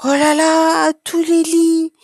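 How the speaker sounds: put-on high, loud childlike amazement; astonished. A narrator's reading. Child discovering the nap room, astonished. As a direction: exclaim in wonder, pitch held up. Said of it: "Apolline est toute étonnée".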